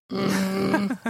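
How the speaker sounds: long disapproving hum; drawn-out grumbling mm; Marge Simpson. Acted. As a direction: hum like a cartoon wife. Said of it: "do the Marge Simpson".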